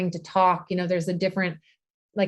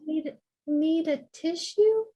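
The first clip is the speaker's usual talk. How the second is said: put-on higher, slow little-child voice; toddler offering; 8 semitones higher, slower than usual. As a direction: offer it sweetly like a two-year-old. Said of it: "she asked me if I needed a tissue".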